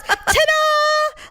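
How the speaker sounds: high squealed ta-da. Retold or imitated. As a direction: pop up and present yourself.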